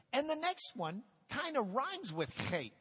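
ordinary delivery

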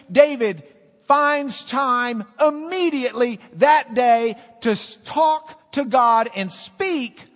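loud, high, shouted praise; fervent full-force preaching. Acted. Pastor shouts God's names to the congregation, fervent. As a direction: lift the church with each claim.

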